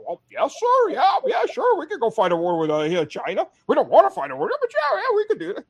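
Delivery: high, squeaky yes-man voice; mocking service chiefs. Mocking the service chiefs answering the senator, high and squeaky. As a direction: ham up the nervous generals agreeing. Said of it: "the service chiefs are just like".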